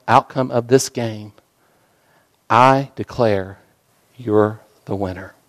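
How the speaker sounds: cradle them with quiet words; tender heavenly father. Acted. Father in heaven to his child, tender and soft.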